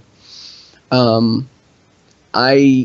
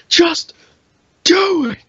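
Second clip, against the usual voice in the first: high, loud motivational yell; Shia LaBeouf shout; 4 dB louder than usual. Retold or imitated. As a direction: scream it like a hype speaker. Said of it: "motivation taunt".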